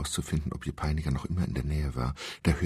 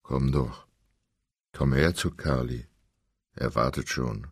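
lower, pressed luring voice; eerie lure; 4 semitones lower than usual. Acted. Captor keeps luring her in a pressed voice. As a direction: coax her like bait. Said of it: "verfiel in eine Art Singsang".

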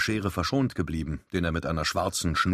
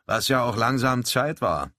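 mock indignation; 6 semitones higher, 3 dB louder than usual. A narrator's reading. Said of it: "mit gespielter Empörung".

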